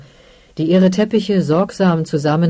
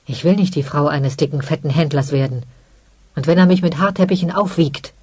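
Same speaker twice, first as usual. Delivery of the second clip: usual speaking voice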